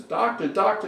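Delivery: high, urgent cries for the doctor; panicked calling. Acted. Igor runs up, calls doctor.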